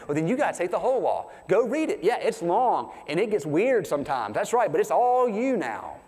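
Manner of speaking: Paul ranting at the church to take every law; ticked-off Paul. Retold or imitated. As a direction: push it to the extreme like a fed-up apostle. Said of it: "Paul's pretty ticked".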